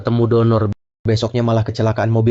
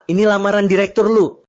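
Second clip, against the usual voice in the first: much higher, bright hype call; rallying call; 10 semitones higher than usual. Acted. Host urging the staff to cheer the proposal, excited. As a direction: pump up the crowd like an emcee.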